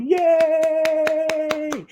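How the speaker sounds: high squealing yay; cheer. Real, not acted. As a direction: cheer for the name. Host cheering the name.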